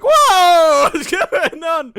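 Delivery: loud, very high shocked yell, laughing; exaggerated re-enacted shock, pitch shooting up; astonished. Retold shock at friend's new voice.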